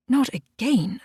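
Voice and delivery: mild narrated gasp. A narrator's reading. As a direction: gasp it out, caught off guard. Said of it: "I gasped".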